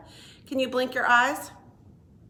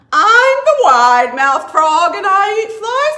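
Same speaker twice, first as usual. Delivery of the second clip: loud, high, bragging frog voice; boastful frog; 9 semitones higher, 13 dB louder than usual. Acted. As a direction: shout it like a proud little frog. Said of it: "said the wide-mouthed frog".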